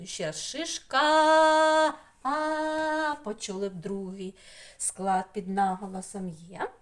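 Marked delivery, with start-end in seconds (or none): 0.4-3.1 "Шишка" with final "а" high, loud and sustained; stressed vowel held. Shown as a demonstration. Teacher repeating the stress for children, long forceful high "а". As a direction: sing out the last "а" of "Шишка" long. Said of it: "Силою голосу".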